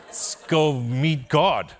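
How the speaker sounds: slow, loud, stretched grand call; mock-epic slow rallying cry; drawn-out hype. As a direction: savor each word like a big announcement. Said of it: "I was like".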